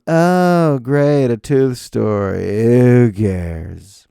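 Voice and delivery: loud, slow, sarcastic deadpan; miserable bored clerk. Retold or imitated. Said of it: "most miserable human I've ever come across".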